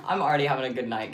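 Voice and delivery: loud fast laughing line. Real, not acted. Streamer giggling to chat about her good night. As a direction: spill it out mid-laugh, giddy. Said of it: "Can you tell?".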